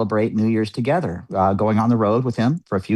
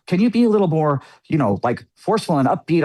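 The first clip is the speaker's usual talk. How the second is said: mimicking the engineer; 7 semitones higher than usual. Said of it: "he's giving me directions".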